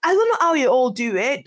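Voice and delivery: ordinary talk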